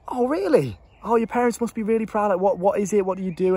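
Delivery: put-on high, impressed older lady; gushing lady. A lady at her car window gushing about his job, high and impressed. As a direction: gush over him like a proud neighbour. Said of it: "And she was like".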